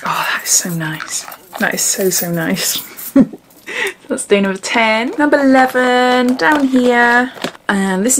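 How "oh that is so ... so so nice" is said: hushed confiding whisper. Real, not acted. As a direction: whisper it like a secret. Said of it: "maybe I shouldn't say this".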